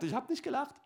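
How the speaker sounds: high, fast, hushed denial; mock-innocent high protest; Sarah denying. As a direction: fib quickly like a caught child. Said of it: "Und sie sagt".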